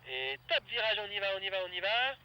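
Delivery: loud urgent calls to the pilot; crié fort, pressant; shouted commands. Real, not acted. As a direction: call it out over the wind. Coach calling commands over radio.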